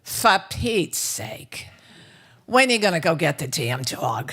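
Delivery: loud, exasperated New York father. Acted. Friend's father fed up with talk of Ruby, New York exasperation. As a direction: bark it slowly in a heavy New York accent. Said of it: "in his very New York voice".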